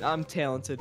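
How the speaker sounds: ordinary talk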